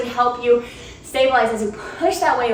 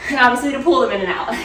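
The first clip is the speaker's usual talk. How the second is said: ordinary talk, nothing marked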